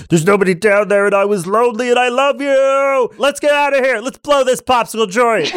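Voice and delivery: high, lonely and loving gum creature; Lord Gum voice. Acted. Lord Gum begs the party to leave with him, high. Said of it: "And he goes".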